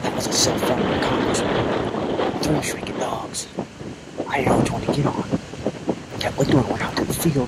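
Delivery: breathless adrenaline rush after the shot; pumped up, loud and fast; excited. Real, not acted. Excited hunter after shooting.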